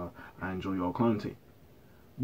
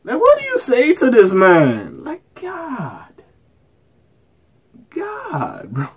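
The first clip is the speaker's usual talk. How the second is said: stunned high plea; 10 semitones higher, much slower than usual. Real, not acted. Stunned by the video.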